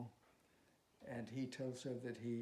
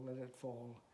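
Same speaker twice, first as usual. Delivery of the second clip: ordinary delivery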